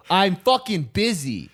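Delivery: high, slow exasperated outburst; retold exasperation. To the canvasser riding alongside, high exasperated brush-off. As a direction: stretch it out high, as an irritated brush-off.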